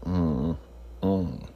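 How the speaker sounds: normal talking voice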